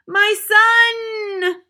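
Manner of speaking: loud high cry of relief; scream. Acted. Mother screams on seeing her son, loud cry.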